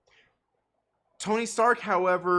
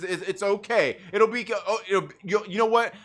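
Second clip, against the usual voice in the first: ordinary delivery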